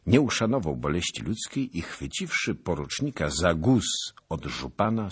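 ordinary delivery, nothing marked

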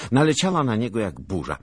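ordinary delivery

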